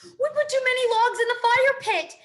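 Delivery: breathless panicked shout; frantic shout. A narrator's reading. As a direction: yell it while running past. Galloping moose calling back.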